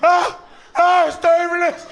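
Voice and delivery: very high, loud panicked shouting; hammed-up shrieking freak-out; mock panic. Passengers freaking out during turbulence, high mock panic. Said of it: "People gonna start freaking out".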